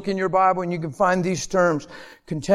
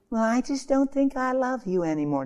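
a touch of a mopey spouse, higher and softer; mock spouse; 5 semitones higher, 4 dB quieter than usual. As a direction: lightly voice the bored spouse leaving. Spouse falling out of love.

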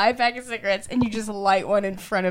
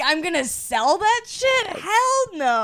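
mock indignant; 8 semitones higher, slower than usual. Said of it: "And you're like".

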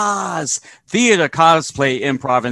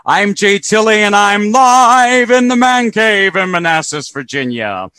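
ringmaster; 5 semitones higher, 7 dB louder than usual. Acted. Podcast intro announcement.

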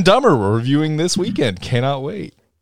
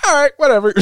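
nonchalant; 13 semitones higher, 4 dB louder, faster than usual. Retold or imitated. Frigga voiced, nonchalant.

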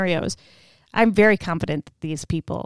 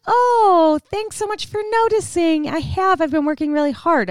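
much higher, eager thank-you; flattered gushing; 8 semitones higher, 4 dB louder than usual. Retold or imitated. Erica thanks the instructor for noticing, flattered. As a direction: beam and gush over the compliment.

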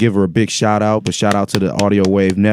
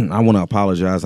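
usual speaking voice

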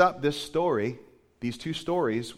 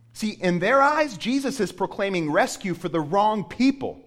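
loud, higher sermon climax; preaching at full force; 4 semitones higher, 6 dB louder than usual. Acted. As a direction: hammer it to the congregation.

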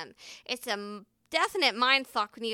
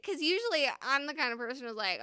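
ordinary talk

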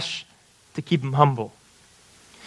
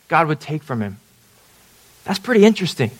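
ordinary talk, nothing marked

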